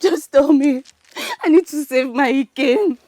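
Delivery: tearful, desperate begging; crying. Acted. Woman begs Ugochi to help save Ikem, crying. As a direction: plead for help through sobs. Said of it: "You have to stop crying".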